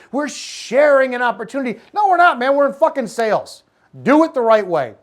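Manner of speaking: high, loud, exasperated rant; emphatic rebuttal. Real, not acted. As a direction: shoot down the euphemism forcefully. Rebuffing the euphemism loudly.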